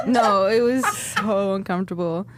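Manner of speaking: laughing. Real, not acted. Laughing over an awkward moment.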